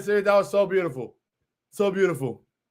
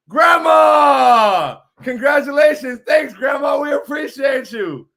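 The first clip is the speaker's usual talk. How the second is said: loud, high, elated shout to grandma; excited shout; 6 semitones higher, 7 dB louder, slower than usual. Real, not acted. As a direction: call out thanks at full voice, thrilled. Excitedly hailing grandma joining in.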